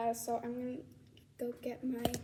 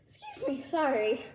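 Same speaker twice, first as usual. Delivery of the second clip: normal talking voice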